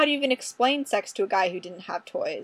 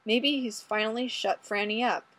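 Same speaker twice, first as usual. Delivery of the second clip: ordinary delivery, nothing marked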